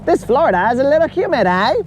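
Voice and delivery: a friend gone Canadian griping about Florida heat; light, jokey Canadian hoser impression; Canadian accent. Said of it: "he's just gonna go".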